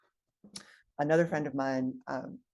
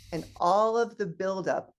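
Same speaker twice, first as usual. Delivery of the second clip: ordinary delivery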